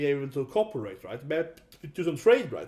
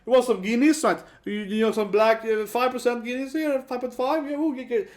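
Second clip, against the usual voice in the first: matey pub invite; 8 semitones higher, 4 dB louder than usual. Retold or imitated. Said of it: "He's kinda like".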